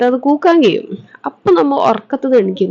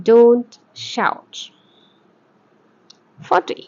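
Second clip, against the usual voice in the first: each sentence said very slowly for students to repeat; slow drill reading; 3 dB quieter, much slower than usual. Shown as a demonstration. Teacher reading English sentences slowly for students to repeat. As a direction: read it out syllable by syllable, like a dictation.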